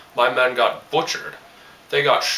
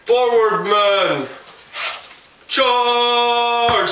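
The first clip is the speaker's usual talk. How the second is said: high, loud charge shout; battle cry; 12 semitones higher, 3 dB louder, much slower than usual. Acted. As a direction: yell the men forward into battle. Soldier leading a charge.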